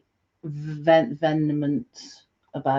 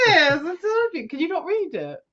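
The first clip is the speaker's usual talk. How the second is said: ordinary talk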